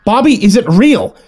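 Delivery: high, slow, emphatic shout. A narrator's reading. Older sibling snaps at sister's make-believe, shouting. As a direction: shout each word out in frustration. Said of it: "I shouted".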